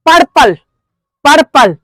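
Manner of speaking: पर्पल said as two firm beats, पर-पल; broken into syllables. Shown as a demonstration. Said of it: "तोड़ के पढ़िए". (पर्पल को दो खंडों में तोड़कर, ज़ोर से)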